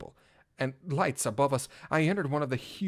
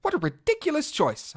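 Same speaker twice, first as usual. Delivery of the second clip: much higher, louder scoffing; snorting scorn; 13 semitones higher, 4 dB louder than usual. Acted. Knight scoffing at policemen's choice, snorting scorn. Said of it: "I snorted".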